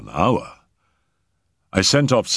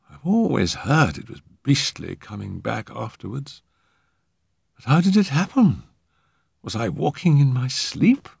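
higher, dazed character voice; puzzled young man; 8 semitones higher than usual. A narrator's reading. Arthur asking how he nearly drowned, puzzled. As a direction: wonder aloud, freshly revived.

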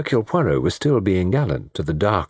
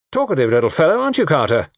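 higher, pushy jibe; needling; 8 semitones higher, 4 dB louder, faster than usual. Acted. Tony teasing a silent man at the table, needling. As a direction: goad him with false heartiness.

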